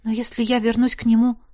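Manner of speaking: ordinary delivery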